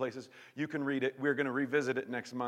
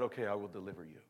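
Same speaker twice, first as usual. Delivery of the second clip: voice of God; 5 semitones lower, 6 dB quieter, faster than usual. Acted. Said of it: "And God said".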